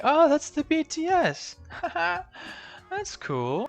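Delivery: hammed-up ditzy voice, laughing; mocking airhead. Mocking family's casual reaction.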